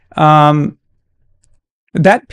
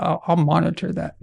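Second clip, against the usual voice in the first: ordinary talk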